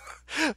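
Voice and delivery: quick real laugh. Real, not acted. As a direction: crack up briefly mid-read. Cracks up reading bat soup message.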